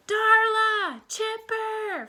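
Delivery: high, drawn-out calls for friends; long high-pitched cry of names. A narrator's reading. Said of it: "Freddie cried".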